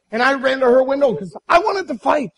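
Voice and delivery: ordinary delivery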